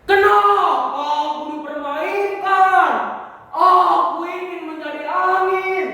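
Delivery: high, loud, drawn-out cry of protest; teriakan protes. Acted. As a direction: wail the wish out at full voice. Tossed cloud cries out in protest. (berteriak lantang memprotes)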